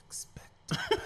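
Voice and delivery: hushed spell under the blanket; whispering. Retold or imitated. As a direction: whisper the spell so mom won't hear. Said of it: "like, just whispering it".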